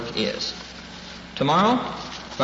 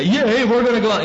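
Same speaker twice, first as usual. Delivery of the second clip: high, loud mock team cheer; rally the team like an eager player; excited put-on player's whoop; cheering football team; 8 semitones higher, 5 dB louder than usual. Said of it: "they say".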